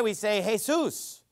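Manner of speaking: Jesus said Spanish style, as hay-SOOS; Spanish pronunciation. Shown as a demonstration. Preacher showing the congregation how Spanish speakers say the name. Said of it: "if we were speaking Spanish".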